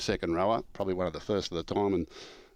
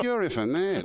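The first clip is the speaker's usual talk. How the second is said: high, louder mock outrage through laughter; laughing retort; 11 semitones higher, 4 dB louder than usual. Player telling his coach he's mad, laughing.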